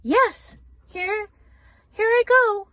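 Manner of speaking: a cheerful tiny creature bouncing off; chirpy mouse. Acted.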